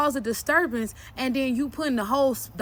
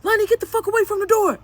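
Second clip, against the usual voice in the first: loud, high-pitched mimicked yell; retold shout, raised well above talk; imitated angry yell; 7 semitones higher, 5 dB louder, faster than usual. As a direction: yell it like the angry dad ordering a child off. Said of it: "and then he yelling".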